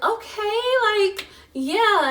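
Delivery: imitating mom, high and exaggerated; impatient mom. Mom hearing the doctor's news by phone, imitated impatient reply. Mom reacting in retold call.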